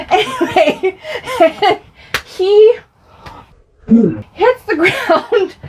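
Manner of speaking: high, helpless laughter through the words; laughing. Real, not acted. Telling of brother's fall, laughing hard. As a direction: struggle to get it out while laughing. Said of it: "I shouldn't laugh".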